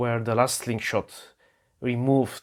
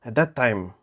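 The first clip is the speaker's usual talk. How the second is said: ordinary talk, nothing marked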